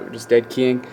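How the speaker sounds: usual speaking voice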